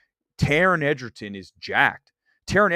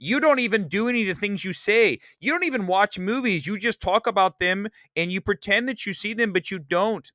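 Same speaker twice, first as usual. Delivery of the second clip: mocking doubters; 7 semitones higher than usual. Twitter people calling him a liar, mocking accusation. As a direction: imitate scornful online naysayers. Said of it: "for the people out there".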